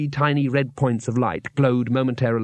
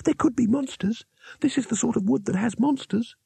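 frightened youngest wizard; 10 semitones higher than usual. A narrator's reading. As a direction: plead in a scared high voice.

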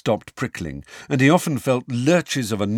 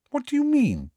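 put-on startled girl voice. A narrator's reading. Hermione alarmed question.

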